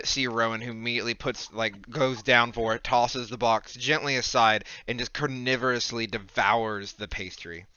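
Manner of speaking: ordinary delivery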